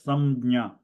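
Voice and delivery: Samnya said carefully with a clear m before nya; teacher modelling the word Samnya; pronunciation demo. Shown as a demonstration. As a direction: sound out Samnya as sam-nya. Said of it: "you pronounce it as".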